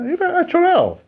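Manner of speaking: high, squeaky mock voice on achorado; playful high-pitched Chilean slang; cheeky slang. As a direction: toss off achorado in a high comic voice. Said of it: "un término nuestro".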